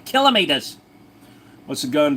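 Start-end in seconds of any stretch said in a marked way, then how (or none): 0.0-0.8 high-pitched Aussie pronunciation; exaggerated Australian accent. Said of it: "I'm sorry, I'm Australian".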